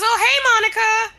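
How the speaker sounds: high, loud, over-bright hello; mock chirpy greeting. Friend's mom greeting guest with the wrong name, chirpy and high. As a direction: chirp it like a too-eager mom.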